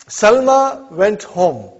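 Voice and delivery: each word slow and clear; slow dictation. Shown as a demonstration. Teacher to students, reading the English sentence slowly. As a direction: spell it out slowly for the class. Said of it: "slow dictation of a drill sentence".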